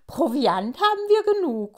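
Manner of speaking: high, loud and pleased; cheerful delight at the food; happy. A narrator's reading. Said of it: "freut sich Pinsel".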